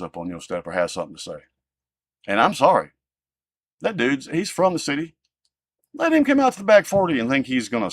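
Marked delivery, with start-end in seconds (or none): none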